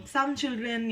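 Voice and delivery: plain reading voice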